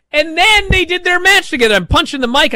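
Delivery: loud, high-pitched excited outburst; thrilled, building to a loud high peak. Real, not acted. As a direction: get worked up and punch out each phrase. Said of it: "I'm so excited".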